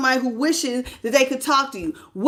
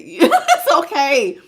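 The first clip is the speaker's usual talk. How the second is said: slow, laugh-filled let-off; words breaking up with laughter; laughing reassurance; 4 dB louder, much slower than usual. Real, not acted. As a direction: let it slide while laughing. Laughing off a viewer's comment.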